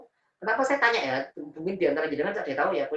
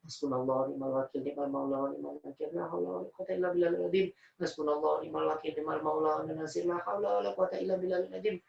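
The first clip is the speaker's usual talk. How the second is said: soft, even devotional recitation; murmured dhikr; 5 dB quieter than usual. Retold or imitated. Worried son doing dhikr in the waiting room, soft chant. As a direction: repeat the prayer quietly, as if to yourself.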